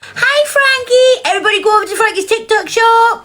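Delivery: high singsong call. Real, not acted. Host plugging Frankie's TikTok shop to viewers, high singsong call. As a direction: call it out high, stretching the name. Greets and plugs a joining viewer.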